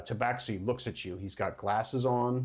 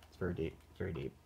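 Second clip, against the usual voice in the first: quick, much softer worried mutter; nervous muttering; 5 semitones lower, 10 dB quieter, much faster than usual. Retold or imitated. Player character at the deep end, quick soft nervous mutter. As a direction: mutter it fast under your breath, spooked by the depth.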